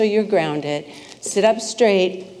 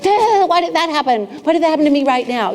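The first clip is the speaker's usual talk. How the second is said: reactive complaint; 7 semitones higher, 4 dB louder, faster than usual. Retold or imitated. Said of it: "Do any of you get reactive in life?".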